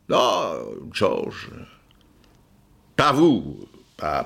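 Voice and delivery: ordinary delivery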